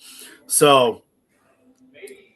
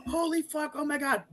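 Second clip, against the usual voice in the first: much higher, squealing amazement; thrilled gasp; 12 semitones higher, 4 dB quieter than usual. Retold or imitated. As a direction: gush over the ring photo. Friends react to ring photo.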